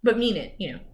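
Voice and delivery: ordinary delivery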